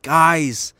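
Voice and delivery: hammed-up panic; mock conservative. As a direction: gullible fan breaking the news. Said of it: "They'd be like".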